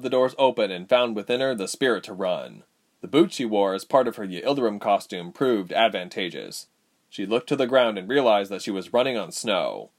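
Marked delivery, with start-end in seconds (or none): none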